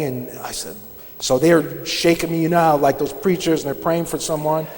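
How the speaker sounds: normal talking voice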